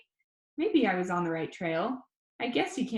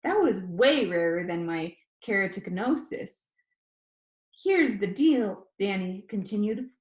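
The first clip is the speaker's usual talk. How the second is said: ordinary delivery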